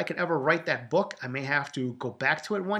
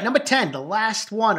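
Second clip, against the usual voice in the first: ordinary delivery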